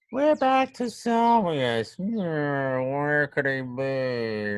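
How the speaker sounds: slow, drawn-out sing-song wondering; playful searching. Pretend. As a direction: stretch the words as you pretend to hunt. Hammy search for Salvius.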